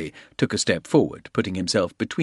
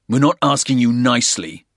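louder, through gritted teeth; 5 semitones higher, 7 dB louder than usual. A narrator's reading. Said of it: "between gritted teeth".

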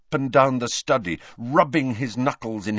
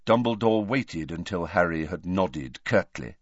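usual speaking voice

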